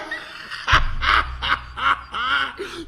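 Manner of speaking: a long rising scornful chuckle; scornful laugh. Real, not acted. Mocking the officials' advertisement, with a rising laugh. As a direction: let the laugh roll up in disbelief.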